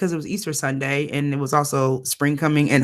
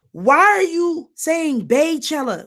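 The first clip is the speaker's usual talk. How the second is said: exasperated; 9 semitones higher than usual. Real, not acted. Irritated rant about nickname.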